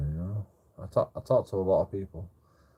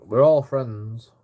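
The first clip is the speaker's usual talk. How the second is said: loud and slow; 3 semitones higher, 8 dB louder, much slower than usual. Retold or imitated. Retold friendly declaration, drunk.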